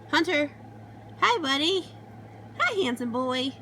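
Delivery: high, sweet voice for the pet; talking to a dog. Real, not acted. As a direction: coo hello to the dog. Greeting the family dog.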